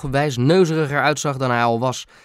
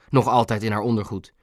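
plain reading voice

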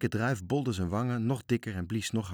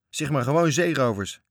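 higher, loud interjection; calling out; 5 semitones higher, 8 dB louder than usual. A narrator's reading. Sailor names the wreckers as pirates, calling out. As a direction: shout it out over the others. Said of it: "riep matroos Tjits nog".

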